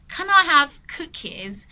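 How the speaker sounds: a hint of a hopeful customer, slow; lightly voiced shopper. Visitor in a shop asking for cookies, mildly acted. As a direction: ask slowly for cookies at the mall. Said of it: "and you're like".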